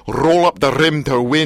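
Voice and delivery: slow Scottish Roll up the rim to win with rolled R's; commercial Scottish accent. Shown as a demonstration. Host demos the coffee commercial, Scottish accent. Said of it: "the way they say it in the commercials".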